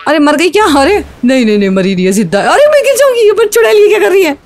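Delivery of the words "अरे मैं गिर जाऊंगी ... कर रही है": much higher, fast, near-crying panic; panicked squeal. Real, not acted. Gamer being shoved off the track by her rival, panicked squeals. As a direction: squeal in panic as you're about to fall.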